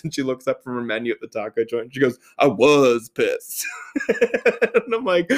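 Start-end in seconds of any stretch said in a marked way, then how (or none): 2.4-3.6 slow, weighty imitation of annoyance; mock-serious, slow admission with weight on WAS; deeper voice. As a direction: put on a deeper voice, drawing out each word.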